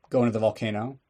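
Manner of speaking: ordinary talk, nothing marked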